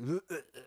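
high-pitched disgusted gagging noises; mock retching. Coworker spitting squid into the trash, mock gagging. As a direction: imitate someone spitting out awful food. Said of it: "immediately spits it into the trash can".

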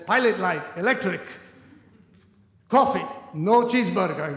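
diner owner refusing the brother's order, mock Greek accent; gruff diner owner. Greek diner owner to brother, lightly voiced accented refusal.

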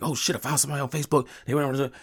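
high, fast excited rush. Retold or imitated. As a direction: blurt it out excitedly. Excited quote to wife.

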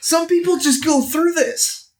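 high, pained yelp; pained exclamation. Acted. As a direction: exclaim after being wounded. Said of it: "kind of muttering to myself".

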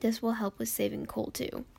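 ordinary talk

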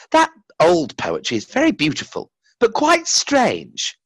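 put-on high, slow child's voice. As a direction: voice a wondering child.

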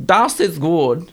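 put-on slow German accent on das ist gut. Showing her friend the German line, slow German accent. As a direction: show him the German way. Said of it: "Can you do a German accent?".

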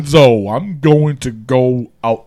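slow, exaggerated Brooklyn accent. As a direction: lay the Brooklyn accent on thick. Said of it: "trying to sound like he's from Brooklyn".

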